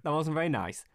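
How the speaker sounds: ordinary delivery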